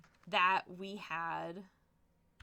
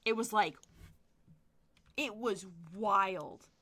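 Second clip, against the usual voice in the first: ordinary talk, nothing marked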